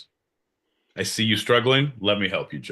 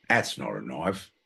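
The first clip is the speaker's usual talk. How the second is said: mock Australian accent; 9 semitones lower, 6 dB quieter than usual. Shown as a demonstration. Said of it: "that's not even the right accent".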